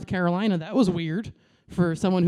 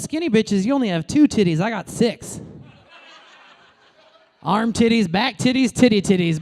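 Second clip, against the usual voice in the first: ordinary talk, nothing marked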